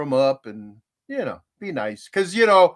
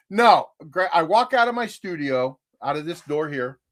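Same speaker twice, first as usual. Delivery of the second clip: normal talking voice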